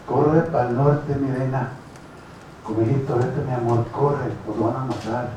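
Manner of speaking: low, slowed, fading dying plea; groaning. A narrator's reading. Dying husband urges his wife to run north, groaning. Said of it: "He groans".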